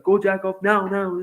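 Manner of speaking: retold stressed order; stressed friend. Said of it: "he's getting stressed now".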